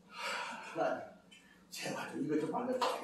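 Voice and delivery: a soft, sighing plea to God; pleading prayer. Retold or imitated. Preacher retelling his own prayer, softly pleading. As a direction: breathe out and beg quietly for the answer.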